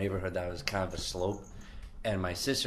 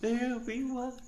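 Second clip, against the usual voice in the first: high mock spooky. Teasing spooky question.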